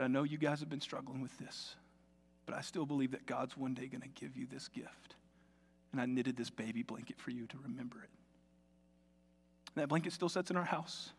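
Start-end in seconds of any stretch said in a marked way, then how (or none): 0.0-6.4 tender. Retold or imitated. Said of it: "She said". 6.4-8.1 whisper. Retold or imitated. Retold old woman's gift.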